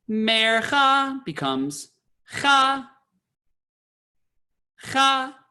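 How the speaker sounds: Mercha sung, then just cha sung high; trope cut short. Shown as a demonstration. Teacher shows mercha shrinking to cha. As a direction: drop the mer, sing only cha. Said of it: "the mer is out".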